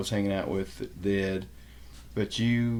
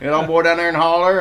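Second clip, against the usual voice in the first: higher and much louder Southern hillbilly accent; 7 semitones higher, 11 dB louder than usual. Shown as a demonstration. Greeting neighbor Spears over the fence, in a hearty Southern hillbilly accent. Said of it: "I start talking like this".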